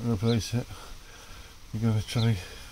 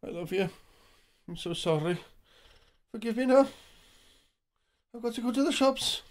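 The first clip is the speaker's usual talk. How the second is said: tender apology to pet; 8 semitones higher than usual. Real, not acted. Apologising to the cat.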